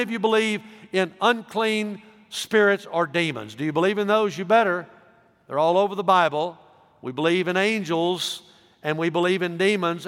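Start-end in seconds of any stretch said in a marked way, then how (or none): none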